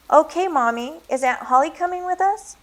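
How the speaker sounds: put-on high hopeful child; little boy voice. A narrator's reading. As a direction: ask like a small kid. Child asks.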